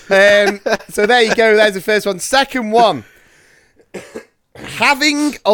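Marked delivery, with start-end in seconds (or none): none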